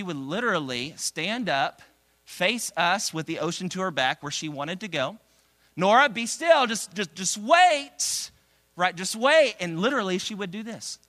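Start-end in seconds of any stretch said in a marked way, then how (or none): 5.8-9.5 higher, louder call to Nora; parent calling toddler. Retold or imitated. Dad at the beach to his toddler Nora, high calling voice. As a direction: call be still and drawn-out wait.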